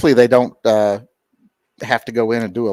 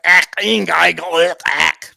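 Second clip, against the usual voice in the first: loud, higher mock German gibberish; 6 semitones higher, 5 dB louder, slower than usual. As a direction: bark out nonsense in a fake German accent. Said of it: "I can do German".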